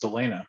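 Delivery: DELANA said as the insisted variant of Delina; insisted name pronunciation. Shown as a demonstration. Host citing someone's insisted version of a name, mispronounced "Delana". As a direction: say "Delana" as the mistaken form of the name. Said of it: "supposed to be pronounced".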